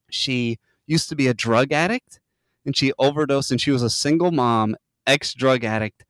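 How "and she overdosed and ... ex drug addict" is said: usual speaking voice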